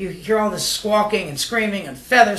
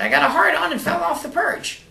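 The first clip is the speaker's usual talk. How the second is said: high squawky parrot voice; 10 semitones higher than usual. Acted. Joke teller voicing the parrot to its owner, high and squawky. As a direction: pitch way up like a talking bird.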